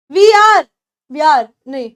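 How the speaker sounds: ordinary talk, nothing marked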